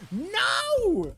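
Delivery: high, sharp outburst of no; sudden cry. Real, not acted. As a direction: yelp it in sudden dismay. Messes up the song.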